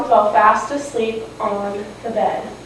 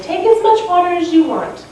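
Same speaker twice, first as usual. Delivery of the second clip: put-on higher-pitched kind girl voice; sweet daughter; 8 semitones higher than usual. Sweet girl sharing water with an old lady, raised voice. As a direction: offer it as a sweet, generous girl. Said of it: "and she said".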